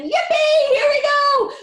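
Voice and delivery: very high, loud whoop; joyful shout. A narrator's reading. Grandpa Joe cheering as the lift speeds up. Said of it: "shouted Grandpa Joe again".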